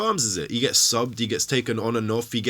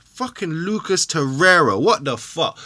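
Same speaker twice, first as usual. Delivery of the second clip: loud, exasperated swearing; angry outburst; 7 semitones higher, 6 dB louder, slower than usual. Real, not acted. Fan raging at Xhaka, loud angry outburst. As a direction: blurt it out in real disbelief. Angry rant about captain.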